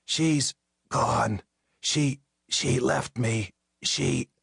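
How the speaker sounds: slow, groaning and broken. A narrator's reading. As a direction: groan the words out drunkenly. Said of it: "another groan".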